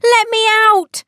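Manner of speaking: a high, loud cry to be freed; tiny shout. Acted. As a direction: Let me out! yelled high and loud. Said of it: "shouted Tom's teeny tiny voice".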